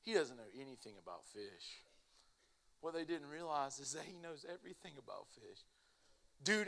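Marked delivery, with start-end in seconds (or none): 0.0-1.8 softer, lower scoff under the breath; muttered mockery of the rabbi; mocking buddies. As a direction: sneer at the newcomer quietly. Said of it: "busting out laughing".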